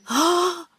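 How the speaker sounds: high startled gasp of a cry; a short frightened cry; little cry. A narrator's reading. The aunt startled by the voice, high little cry. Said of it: "giving a little cry".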